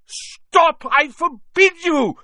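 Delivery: loud, high, frantic order; panicked yell. A narrator's reading. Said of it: "yelled Uncle Vernon in panic".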